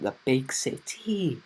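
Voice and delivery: lilting, badly faked Welsh accent. Shown as a demonstration. YouTuber joking about his trip to town, bad Welsh accent. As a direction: have a poor go at a Welsh accent. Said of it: "that was my worst Welsh accent ever".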